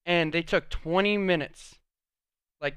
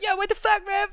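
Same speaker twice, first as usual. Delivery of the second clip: soccer taunt; 3 dB louder than usual. Retold or imitated. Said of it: "I'd be like".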